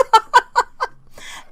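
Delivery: bright high burst of laughter; a real high delighted laugh. Real, not acted. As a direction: laugh out loud at the surprise. Laughs at the shawl surprise.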